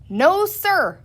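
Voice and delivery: high, loud retort; cried out. A narrator's reading. Sheriff answers Penny, loud and scornful. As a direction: fling it back at the crowd. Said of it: "cried the sheriff".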